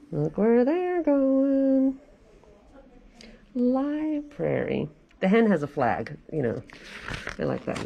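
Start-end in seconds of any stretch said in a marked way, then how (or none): none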